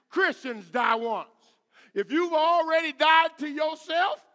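loud, high pulpit exhortation; fired-up preaching. Real, not acted. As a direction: challenge the congregation with full voice. Exhorts congregation at full force.

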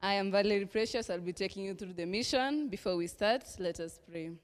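plain reading voice

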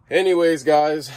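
amused little laugh into the next topic. Real, not acted. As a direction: laugh through the words. Laugh heard while signing off.

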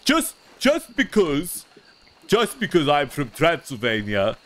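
a campy Transylvanian noble protesting he's not a vampire; Lord Valdemar voice. Acted. Streamer doing his silly vampire character, campy and indignant. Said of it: "Lord Valdemar's really fun to play".

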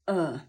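E as a dull, low "uh"; frog vowel. Shown as a demonstration. Teacher names it the frog sound, low "uh" for E. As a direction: say E like a frog, tongue dropped to "uh". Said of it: "żabie".

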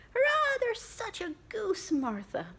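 put-on high, thrilled kids' cheer; children bursting about the goose; excited children. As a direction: gasp it out with delight. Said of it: "cried the two young Cratchits".

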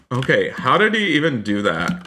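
normal talking voice

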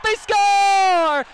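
drawn-out shouted goal call; long goal yell. Real, not acted. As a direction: hold score loud.

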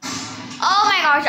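usual speaking voice

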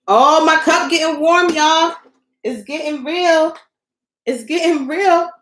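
high, loud alarmed cry over the hot cup; rising panic. Real, not acted. Crafter alarmed on live as her resin cup warms, high panic.

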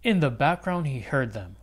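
ordinary delivery

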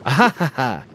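short, loud happy cackle; bright burst of "ha ha ha"; happy laugh. Real, not acted. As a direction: laugh out loud, pleased at a find. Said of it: "That sounded like a happy laugh".

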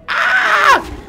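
sudden high shriek; startled scream. Real, not acted. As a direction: shriek as something jumps at you. Sudden scare.

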